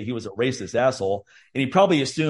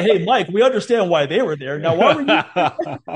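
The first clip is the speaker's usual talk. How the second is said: laughing self-mockery; 4 semitones higher than usual. Mocking himself in others' voice.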